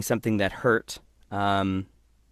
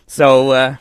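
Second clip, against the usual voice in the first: ordinary talk, nothing marked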